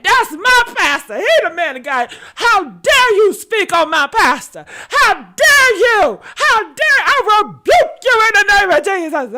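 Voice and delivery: high, loud mock outrage; indignant churchgoer. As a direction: shriek it like an offended believer defending the pastor. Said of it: "They said".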